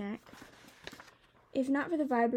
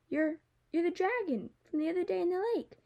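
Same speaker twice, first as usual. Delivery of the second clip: higher-pitched, stammering realization; startled recognition; 8 semitones higher than usual. Acted. Girl realizing the boy is the lake dragon, startled. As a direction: stammer it out as it dawns on you.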